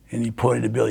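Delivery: ordinary delivery, nothing marked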